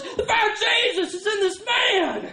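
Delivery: a high, ringing preacher's shout; preacher's proclamation. Retold or imitated. Visiting preacher announcing a miracle, high triumphant shout. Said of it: "the African guy says".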